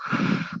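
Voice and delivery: a quiet, heavy sigh to calm down; long unvoiced sigh. Real, not acted. Vlogger calming herself after a rant, soft sigh. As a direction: let out a slow breath. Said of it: "I need to just breathe".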